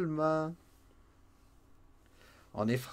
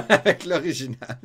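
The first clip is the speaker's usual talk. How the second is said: teasing laugh; 4 semitones higher, 8 dB louder than usual. Real, not acted. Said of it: "je taquine".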